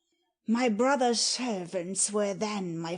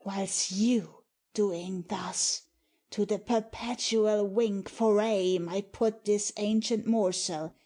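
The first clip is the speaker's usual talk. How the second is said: normal talking voice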